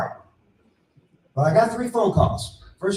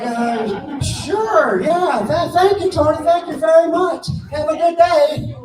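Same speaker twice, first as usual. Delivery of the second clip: much higher and louder gushing thank-yous; a stunned man gushing thanks down the phone; giddy, loud gratitude to the money guy; overexcited thanks; 9 semitones higher, 5 dB louder, slower than usual. Retold or imitated. Thanking Tony for big news.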